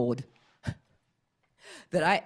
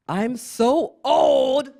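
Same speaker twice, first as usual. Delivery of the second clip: very slow, high, louder; drawn-out lament; 8 semitones higher, 7 dB louder, much slower than usual. Acted. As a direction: stretch it out like an old complaint. Comic lament of age.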